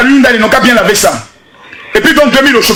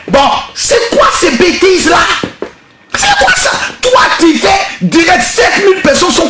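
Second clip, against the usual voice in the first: exasperated scolding; 7 semitones higher than usual. Real, not acted. Scolding the singer's behaviour.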